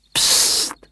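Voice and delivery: voiceless hiss for silence; hushing. Acted. Csipek hushing his friend before creeping up, a voiceless shush. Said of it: "intette csendre barátját Csipek".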